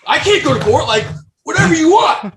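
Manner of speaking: loud, high, pleading cry; desperate shout. Retold or imitated. To his ex threatening court, a desperate loud shout. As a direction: shout it like you're cornered and broke.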